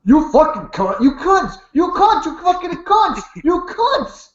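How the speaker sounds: high, rising shrieking rant; screaming insults. Retold or imitated. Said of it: "this is a Sty hangout".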